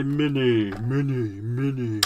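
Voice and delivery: loud announcer-style call, echoing the last word; silly, loud, drawn-out echo; playful echo call. Pretend. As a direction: belt out the name, then repeat it like an echo. Childish play echoing a product name.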